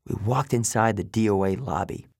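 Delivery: plain reading voice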